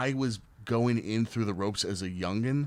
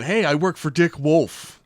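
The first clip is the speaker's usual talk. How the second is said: slow, louder, self-assured pitch; smooth name-drop; 6 semitones higher, 7 dB louder, slower than usual. Retold or imitated. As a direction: sell the line slowly to the cop. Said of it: "I used the line".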